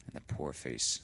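plain reading voice

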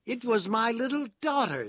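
high, loud, plaintively proud character voice; proud but sorry prisoner; doleful pride. A narrator's reading. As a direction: boast mournfully, pitched well up. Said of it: "with a sort of doleful pride".

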